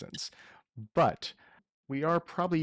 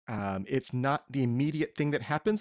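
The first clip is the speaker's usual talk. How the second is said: ordinary talk, nothing marked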